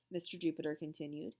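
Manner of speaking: ordinary delivery, nothing marked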